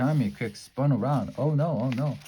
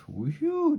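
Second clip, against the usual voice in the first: relief sigh. A narrator's reading. Relieved after hiding.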